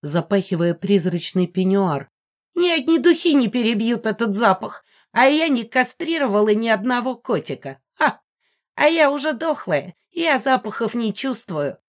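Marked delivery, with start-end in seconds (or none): 2.5-11.8 high-voiced, haughty old woman; indignant old ghost. A narrator's reading. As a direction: retort as an affronted dead aunt. Megara the ghost answers back.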